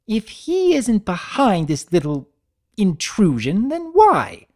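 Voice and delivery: high, theatrical demand; Rarity voice. Acted. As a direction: play a suspicious fashion mare.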